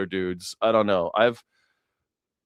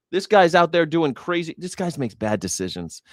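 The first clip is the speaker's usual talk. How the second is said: usual speaking voice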